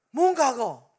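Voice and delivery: loud, sharp acted comeback; defensive retort. Friend challenged about the past, acted loud defensive retort. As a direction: burst out loudly, imitating a challenged friend.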